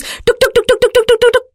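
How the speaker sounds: rapid high tuk tuk chatter; fast engine sound. Shown as a demonstration. Narrator imitating the rickshaw racing fast. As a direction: rattle it off quick like a speeding engine. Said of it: "when he moves fast".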